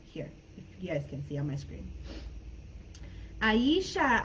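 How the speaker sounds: usual speaking voice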